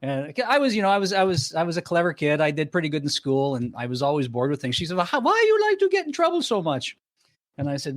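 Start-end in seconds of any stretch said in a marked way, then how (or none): 5.0-6.9 put-on mother's Hungarian accent, much higher. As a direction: mimic the Hungarian mother scolding, high pitched. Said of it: "okay, with a Hungarian accent".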